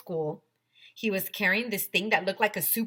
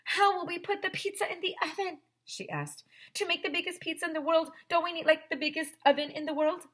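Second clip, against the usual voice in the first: put-on high, quick little-girl worry; higher, faster voice for a worried classmate; 11 semitones higher, faster than usual. A narrator's reading. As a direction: fret like a know-it-all girl.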